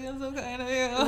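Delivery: on the verge of tears. Retold or imitated. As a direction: thank a rescuer as if about to cry. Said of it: "on the verge of tears".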